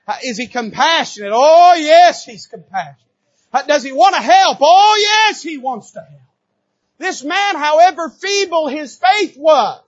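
high, loud call and answer; "Oh, yes" cried out to the congregation; preaching. Acted. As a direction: preach it with rising fervour. Preaching at full force.

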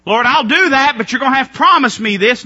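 mock dealmaker pleading terms; bargaining prayer. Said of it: "We do that with the will of God".

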